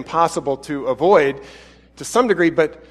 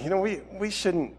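ordinary delivery

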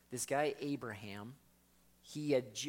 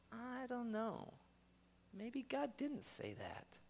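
much higher, softer, slow doubter; doubting voice; 10 semitones higher, 7 dB quieter, slower than usual. Acted. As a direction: wonder aloud, unsure and small. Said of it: "this voice comes in that says".

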